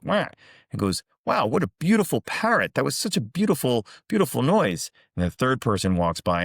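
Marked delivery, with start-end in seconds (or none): 0.0-0.3 single imitated parrot squawk. Parrot squawking at the second passerby, mock squawk. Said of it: "and the parrot goes". 1.2-4.9 high, swooping mock delight; gushing admiration. Second passerby admiring a parrot's squawk, exaggerated gushing.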